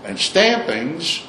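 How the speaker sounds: "stampings" drawn out high and slow; emphatic. Real, not acted. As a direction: lean hard on "stampings". Emphatic stressed topic word.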